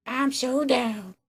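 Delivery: put-on very high, slow, airy girl voice; drawn-out mock Emma. Mocking Emma eagerly agreeing to the trip. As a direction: stretch it out like a ditzy teen. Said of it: "she went".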